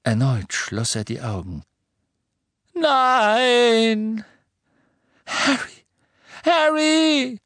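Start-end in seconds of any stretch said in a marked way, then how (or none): 2.7-7.4 high loud desperate cries; anguished screams. Acted. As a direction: scream his name in grief. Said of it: "Rons, Hermines und Ginnys Stimmen".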